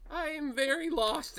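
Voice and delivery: high-pitched, sheepish character voice; Philip. Acted. Nervous man is shoved the right way, high and sheepish. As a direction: pitch it way up, helplessly lost. Philip turned around, confused.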